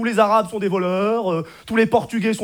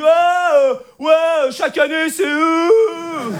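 higher, drawn-out outraged wail; mock protest shout; 5 semitones higher, much slower than usual. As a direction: shout like a sore loser in the crowd. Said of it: "ça gueule".

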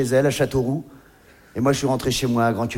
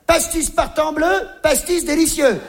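higher, slow sing-song saying; quoting Jackie; 8 semitones higher, slower than usual. Retold or imitated. Jackie reciting his drinking motto, sing-song. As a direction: recite it like a proud rhyme. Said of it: "Jackie disait".

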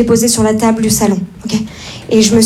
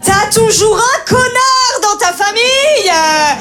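drawn-out exasperated whine, pitched up; exasperated rant; 7 semitones higher, slower than usual. Acted. Comedian ranting about relatives, exasperated whine. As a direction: complain about the family jerk.

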